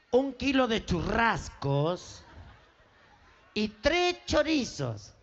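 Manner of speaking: slow, over-articulated shopping list; slow dictation. Acted. Mother sends son to butcher, slow careful dictation. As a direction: spell out each item slowly for the boy.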